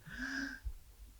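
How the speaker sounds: sudden high, audible intake of breath; demonstrated gasp for air. Shown as a demonstration. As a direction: gasp as if out of air mid-speech. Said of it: "de repente tiene que parar y hacer". (bocanada de aire fingida)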